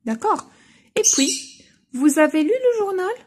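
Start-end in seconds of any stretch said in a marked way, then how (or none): 1.9-3.1 "Vous avez lu le journal?" much higher and louder, rising on "le"; rising question tune for pupils; question intonation. Shown as a demonstration. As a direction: lift it into a clear spoken question. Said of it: "la tonalité ديال la question".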